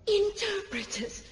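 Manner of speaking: whisper. Acted. Scornful echo of the elder.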